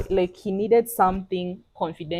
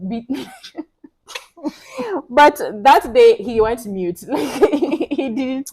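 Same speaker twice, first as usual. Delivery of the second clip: ordinary delivery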